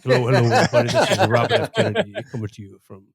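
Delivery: deep, smooth soul-singer voice as a president. Pretend. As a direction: croon it low like a late-night soul DJ.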